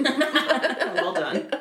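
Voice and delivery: loud, high, helpless laughter into words; cracking up while congratulating; laughing. Real, not acted. Friend spat out drink laughing.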